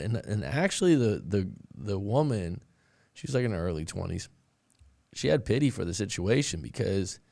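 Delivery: ordinary talk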